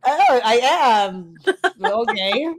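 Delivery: high, loud, long drawn-out reply; hammed-up flattered realization; mock flattered surprise. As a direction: play up being pleasantly taken aback. Acts flattered reply to admirers.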